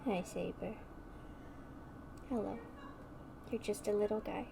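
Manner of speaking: hushed, tender voice for a cat; soft cooing. Real, not acted. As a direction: murmur gently to a pet.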